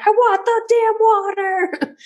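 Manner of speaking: high, slow frustrated cry; mock angry yell. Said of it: "I'm getting so mad at it".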